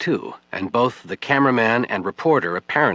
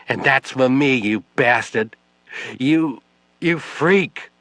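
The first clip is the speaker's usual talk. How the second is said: slow, higher, loud last insults; spent fury; 5 semitones higher, 4 dB louder, slower than usual. Acted. Exhausted widow slapping Webb, last furious words. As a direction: drag out the final insult.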